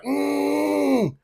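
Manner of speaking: high, strained imitated grunt; mock grunt. Kid charging at him, high mock grunt. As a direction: squeeze out a high kid's grunt.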